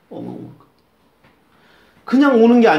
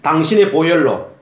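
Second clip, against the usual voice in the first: solemn prayer phrase to repeat; 9 dB louder than usual. Shown as a demonstration. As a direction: say it out loud and clear for them to echo. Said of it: "한번 따라해 보실까요?".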